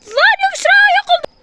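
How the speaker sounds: high shriek of fear; panicked scream. Real, not acted. Panic, sister vanished.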